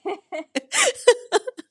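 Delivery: high, loud burst of laughter. Real, not acted. Woman amused by the absurd shoe size, laughing high.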